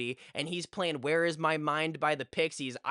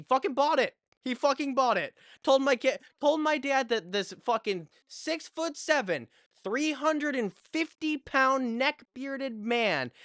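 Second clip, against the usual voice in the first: high, loud, can't believe he bought it; incredulous excitement; 10 semitones higher, 4 dB louder than usual. Real, not acted. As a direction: ramp up into amazed disbelief. Incredulous punchline about lying to dad.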